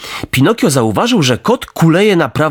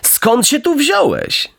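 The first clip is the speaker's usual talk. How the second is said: high, slow, syrupy fox greeting; sly fox; 14 semitones higher, slower than usual. Acted. Fox feigning delight at meeting Pinocchio, high and slow. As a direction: greet him with fake warmth. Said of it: "zawołał lis".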